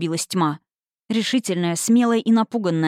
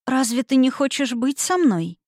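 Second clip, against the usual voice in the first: higher-pitched languid seductress; 6 semitones higher than usual. A narrator's reading. She lures the hero to her, languid. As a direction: coax him with a languid question. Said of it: "спросила томно".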